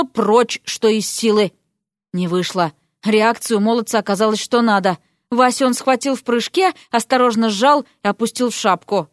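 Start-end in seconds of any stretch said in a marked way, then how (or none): none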